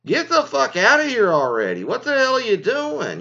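exasperated outburst. Retold or imitated. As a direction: blast the sneezer, half-joking exasperation. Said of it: "you're like".

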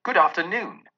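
put-on curt old miser. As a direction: cut him off. Scrooge dismissing nephew curtly.

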